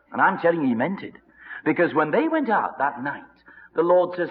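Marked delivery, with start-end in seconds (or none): none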